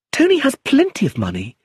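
put-on young woman angrily defends Tony to her guardian; crying out angrily. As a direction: snap back in her friend's defence. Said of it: "she cried angrily".

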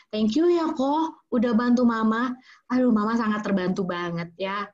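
loud bright grateful thank-you; appreciative thanks. Shown as a demonstration. Mother thanking son for helping, warm and loud. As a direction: thank him warmly and fully. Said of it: "jangan lupa diapresiasi".

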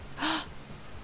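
sharp breathy gasp; sudden surprised gasp. Real, not acted. Reacts to game event.

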